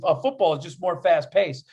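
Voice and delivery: usual speaking voice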